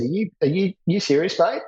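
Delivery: mock outrage, "are you serious, mate?"; incredulous. Section commander to warrant officer, retold incredulous disbelief. Tim incredulous at boot order.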